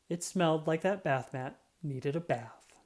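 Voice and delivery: usual speaking voice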